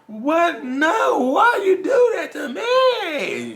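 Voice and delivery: very high, loud, drawn-out cry; hammed-up heartbroken shriek, last word stretched out; mock wail. Acts out hurt partner wailing.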